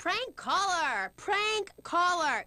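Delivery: high, slow drawn-out call; sing-song exposé, pitched high; alarm call. Acted. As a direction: call it out like an alarm, stretching each word. Host denounces prank caller on air.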